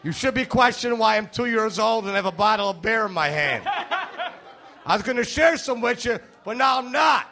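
a mock-offended toddler takes back his beer; two-year-old impression. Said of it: "That's my impression of a two-year-old".

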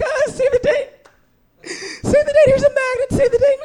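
very high, squealing mock excitement; bubbly bride-to-be. Comic mocking newly engaged women, bubbly squeal. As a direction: squeal it like a giddy fiancée. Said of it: "always so bubbly and happy".

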